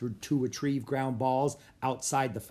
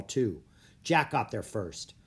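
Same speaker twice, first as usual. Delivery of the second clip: ordinary delivery, nothing marked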